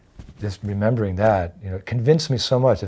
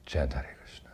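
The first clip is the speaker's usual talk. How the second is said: softly whispered instruction; 7 semitones lower, 12 dB quieter than usual. Retold or imitated. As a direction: breathe it out gently after tears. Guru speaking after weeping.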